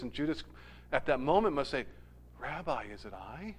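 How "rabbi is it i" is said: much softer and lower, a quiet question; hushed question. Retold or imitated. Judas quietly asking Jesus at supper, hushed. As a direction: ask it barely aloud.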